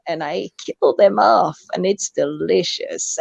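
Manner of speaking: low, slow villain's relish; villainous. Real, not acted. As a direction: savour it slowly like a villain, dropping low. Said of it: "I went all villainous there".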